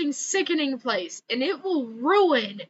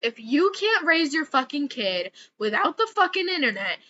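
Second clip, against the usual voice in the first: usual speaking voice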